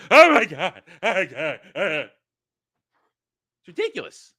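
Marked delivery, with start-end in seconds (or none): 0.1-2.1 loud mock cry, tailing off; mock panicked men. Men cowering before a roaring heroine, mock panic. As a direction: imitate men wailing in exaggerated alarm. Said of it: "and men going".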